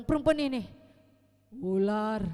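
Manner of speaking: loud, pointing-the-finger retort. Retold or imitated. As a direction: toss the blame onward. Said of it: "Lalu bilang".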